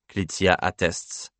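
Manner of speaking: usual speaking voice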